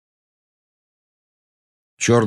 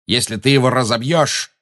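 raised, alarmed bark; barked warning; 4 semitones higher, 3 dB louder than usual. A narrator's reading. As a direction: snap it out higher, as a threat. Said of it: "рявкнул на неё Люциус Малфой". (рявкнуть с угрозой)

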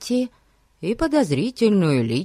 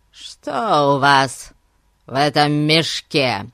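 loud, slow, heavy questioning; stern interrogation; 4 dB louder, slower than usual. A narrator's reading. Гун questions the old man about his sack, stern. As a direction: draw out each word with weighty authority.

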